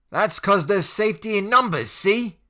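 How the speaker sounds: high, loud smug taunt; cocky bully; grinning smugly. Acted. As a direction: gloat with a grin.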